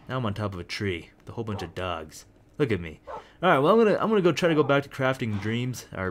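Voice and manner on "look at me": ordinary talk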